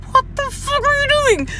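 very high-pitched mock outrage; friend's appalled hiss. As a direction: imitate a horrified friend scolding you. Friend hitting her, appalled.

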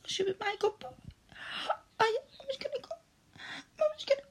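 very high, breathless and straining; climaxing. Acted. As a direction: let the voice climb and crack. Climax moment, voice climbs high.

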